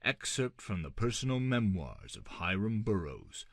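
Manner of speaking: plain reading voice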